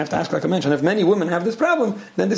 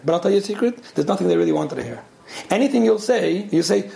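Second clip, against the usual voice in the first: ordinary talk, nothing marked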